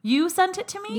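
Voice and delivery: sudden high, loud disbelief; surprised. Real, not acted. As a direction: genuinely caught off guard, voice jumps up. Learns husband got graphic from friend.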